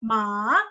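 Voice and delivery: "ma" long, dipping low then rising; drawn-out "ma" with a valley in the middle; third-tone MA. Shown as a demonstration. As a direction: sink "ma" low, then swing it up. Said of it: "Dan ton tiga".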